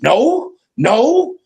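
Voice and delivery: put-on scared kid pleading. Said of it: "the same way I used to be as a kid".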